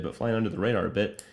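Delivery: ordinary talk, nothing marked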